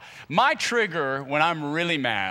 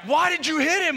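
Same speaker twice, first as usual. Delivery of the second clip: mock outraged family; 14 semitones higher than usual. Family yelling at him.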